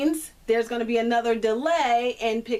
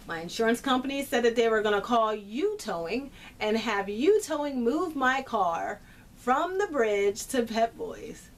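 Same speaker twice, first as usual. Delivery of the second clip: usual speaking voice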